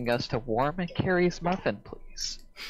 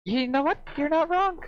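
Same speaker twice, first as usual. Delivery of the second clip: high-pitched; 14 semitones higher than usual. Acted. High mock concession.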